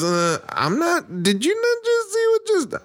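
high-pitched, incredulous stammer; imitated stunned refusal. Husband to mom, backing out, high mock disbelief.

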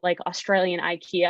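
normal talking voice

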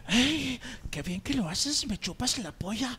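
lightly acted porn dub in Spain Spanish; a hint of mock pleasure, Spanish accent; Spanish porn dubbing. Shown as a demonstration. Guest demonstrating porn dubbing, lightly mock-moaned Spanish accent. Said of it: "regularmente acento español".

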